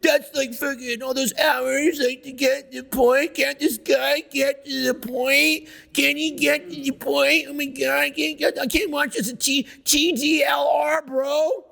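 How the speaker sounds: whiny impatient complainer. Retold or imitated. Speaker mocking viewers who want him to get to the point, whiny impatient complainer. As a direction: drag it out like a griping, exasperated viewer. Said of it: "People be like".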